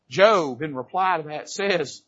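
usual speaking voice

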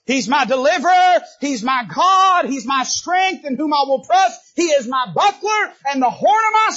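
shouted, high, ringing praise; preaching at full force. Acted. Preacher proclaims the psalm to the congregation, shouting. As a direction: proclaim it to the rafters.

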